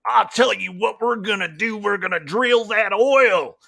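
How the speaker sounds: overblown, badly faked American cowboy accent; British TV American. British actor playing an oil-drilling American cowboy, hammed-up. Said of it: "a cowboy who's just like".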